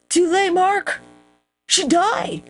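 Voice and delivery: high-pitched messenger crying out bad news; hammed-up herald's announcement; mock messenger. Acts out messenger bringing bad news.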